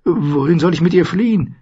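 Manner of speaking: high, bewildered question; puzzled and at a loss; confused. A narrator's reading. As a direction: ask helplessly, pitched well up. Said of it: "fragte Sam verwirrt".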